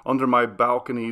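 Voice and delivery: ordinary delivery, nothing marked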